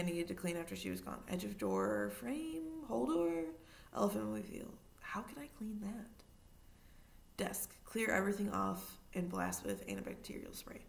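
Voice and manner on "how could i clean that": slow, soft whisper to oneself; whispered thought. A narrator's reading. Matthew silently frets over cleaning the mobile, slow whisper. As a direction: breathe the worry out slowly.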